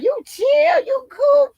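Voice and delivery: squealing high-pitched praise; high excited shout. Retold or imitated. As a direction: shriek it way up high and loud. Coworkers praising her, retold loudly.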